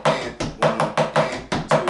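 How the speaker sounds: slow, deliberate count; teacher slowly counting beats; slow counting demo. Shown as a demonstration. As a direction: spell out each beat slowly for the student. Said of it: "If you play four notes in a row".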